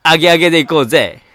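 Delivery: loud party-hype voice; gyaru speech. Acted. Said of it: "ヒップホッパー". (大声でノリノリのパーティー口調)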